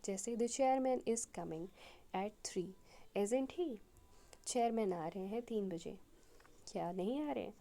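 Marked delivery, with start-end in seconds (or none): 0.4-3.8 "isn't he?" lifted at the end as a real question; rising question tag. Shown as a demonstration. Teacher shows a real question tag, rising intonation on "isn't he?". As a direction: let the pitch climb on "isn't he?". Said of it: "it has a rising into-, intonation".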